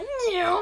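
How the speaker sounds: high squeaky mock meow; cat meow. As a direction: mew like a panicking cat. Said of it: "He's like".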